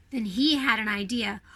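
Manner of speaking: usual speaking voice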